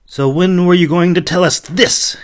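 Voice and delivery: loud, high angry question; demanding. Acted. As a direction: confront him angrily. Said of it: "he demanded".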